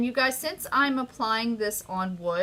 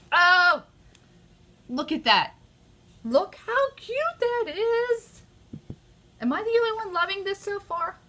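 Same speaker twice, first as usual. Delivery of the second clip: high, squealing burst of real excitement; overexcited squeal; 12 semitones higher, 6 dB louder than usual. Real, not acted. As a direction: gush over the colours, voice leaping up. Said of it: "I get excited".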